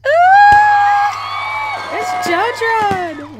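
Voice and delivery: very high, loud squeal, sliding into drawn-out words; excited fan scream. Real, not acted. Player seeing a favourite character appear, excited scream.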